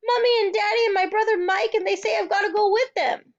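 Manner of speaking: high, loud upset telling; upset girl; distressed child. A narrator's reading. Frantic child explains.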